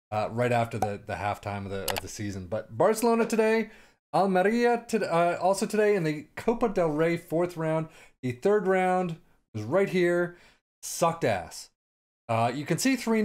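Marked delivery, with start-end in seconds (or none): none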